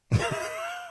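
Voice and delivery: a sudden falsetto giggle; high squeaky laugh. Real, not acted. Laughing at his own joke.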